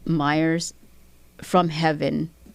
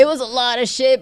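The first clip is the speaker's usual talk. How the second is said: pitched-up laughing repeat; 7 semitones higher, 6 dB louder than usual. Real, not acted. Repeating to her host, now laughing. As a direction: laugh it out the second time. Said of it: "repeated with a laugh".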